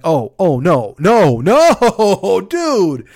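the moment you see the wrong on-ramp; dismayed realization. Real, not acted. Realizes he took wrong freeway.

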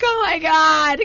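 high, loud panicky wail; a high, half-laughing shriek of alarm; mock panic. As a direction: squeal in fake horror at the lost signal. Panicking over no phone service.

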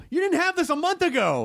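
high, scolding voice at whiny fans; mocking scold. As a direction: talk down to them like kids.

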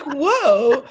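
loud, high amazed whoa. Retold or imitated. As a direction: marvel out loud. Amazed in celebrity's house.

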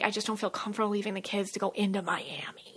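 hushed, almost whispered; whispered confession. Retold or imitated. Telling her friend the truth about the kids, whispered. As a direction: confide it under your breath.

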